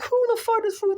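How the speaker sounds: a squeaky, stunned "who is this"; high incredulous outburst. Retold or imitated. Retelling to viewers how a stranger baffled him, high and incredulous. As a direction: pitch it way up in disbelief.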